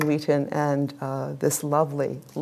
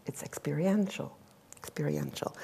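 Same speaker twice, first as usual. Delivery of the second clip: a soft whisper to make the point sink in; hushed emphasis; 3 semitones lower, 12 dB quieter than usual. Real, not acted. Lecturer whispers her key point to the audience, hushed. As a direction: whisper the word experiential twice, dropping away. Stressing the key word softly.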